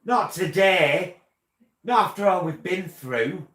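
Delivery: hammed-up outrage, wailing it out; mock horror. Mock dismay over smudged eyeliner.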